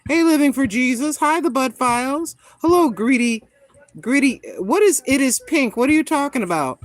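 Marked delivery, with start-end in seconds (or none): none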